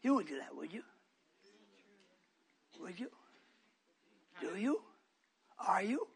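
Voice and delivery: low soft searching questions; hushed questioning. Real, not acted. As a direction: probe them gently, almost whispering. Quiet probing questions.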